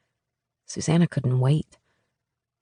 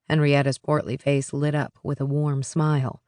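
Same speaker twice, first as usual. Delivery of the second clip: usual speaking voice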